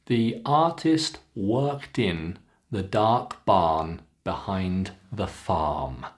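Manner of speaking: slow, long "artist", "dark", "barn". Shown as a demonstration. Teacher models practice sentence, slow long ah to "farm". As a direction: stretch each ah, drop down on "farm". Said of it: "keep the R sound nice and long".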